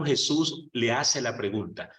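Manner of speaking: normal talking voice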